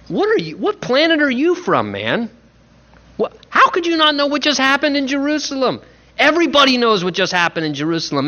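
high, incredulous "what planet are you from"; shocked disbelief. Retold or imitated. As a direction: sound floored that anyone missed the news. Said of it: "Imagine how shocked they felt".

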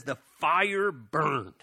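very slow, weighty verse ending; drawn-out pulpit recitation; scripture reading. A narrator's reading. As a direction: stretch each word out for drama. Reads the psalm verse aloud.

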